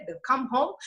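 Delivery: ordinary talk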